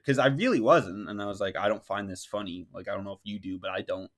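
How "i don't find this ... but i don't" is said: low, softened retold reply; politely standing your ground, softly; nice, calm. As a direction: keep it quiet and non-confrontational. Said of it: "I'm very nice about it".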